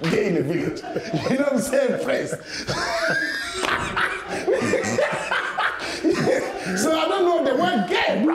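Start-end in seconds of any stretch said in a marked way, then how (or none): 2.4-6.7 high wheezing laughter; long laughing fit. Real, not acted. Friend laughs helplessly at his own story, high wheezing. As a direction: crack up until breathless.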